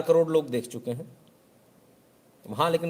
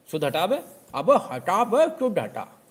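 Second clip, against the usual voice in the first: higher, louder scornful local tough; mock scolding; 7 semitones higher, 6 dB louder, slower than usual. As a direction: bark at the shopkeeper to drop the word. Customer scolding shopkeeper.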